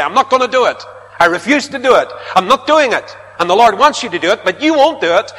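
loud, high, defiant refusal; stubborn refusal. Retold or imitated. A stubborn believer refusing God, loud and defiant. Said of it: "You say".

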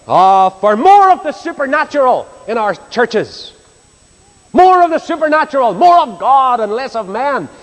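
drawn-out pulpit plea, rising high; impassioned preaching. Real, not acted. Preacher pleads for God in the churches, impassioned preaching. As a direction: cry out for it, stretching the key words.

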